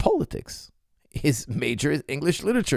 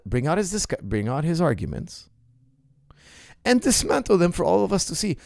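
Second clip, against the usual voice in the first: usual speaking voice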